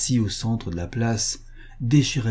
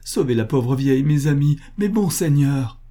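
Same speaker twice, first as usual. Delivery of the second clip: higher-pitched, urgent begging; desperate pleading; 6 semitones higher, 4 dB louder than usual. A narrator's reading. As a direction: implore them, voice raised. Butcher begs the Poles for help.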